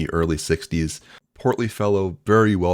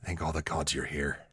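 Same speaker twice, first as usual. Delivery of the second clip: put-on hushed Scottish accent; very soft, confiding Scottish brogue; 11 dB quieter than usual. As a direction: whisper it softly with a Scottish accent. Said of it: "a Scottish accent there".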